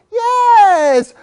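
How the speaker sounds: a loud high burst of yes; excited shout. Real, not acted. Trainer answering her audience with an excited shout. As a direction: shout it out, thrilled.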